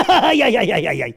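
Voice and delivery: a laughing yelp. Acted. Karagöz yelps with a laugh when poked.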